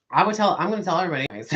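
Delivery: loud, rushed boast to tell everyone; loud eager blurt. Retold or imitated. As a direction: blurt it out with glee. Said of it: "I'm like".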